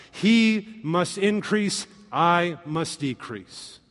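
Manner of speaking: x0.5 slow, every word given weight; solemn slow scripture reading; slow, weighty reading. Real, not acted. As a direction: lay the verse down slowly and deliberately. Reading the key verse.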